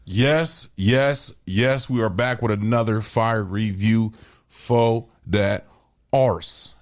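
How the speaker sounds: usual speaking voice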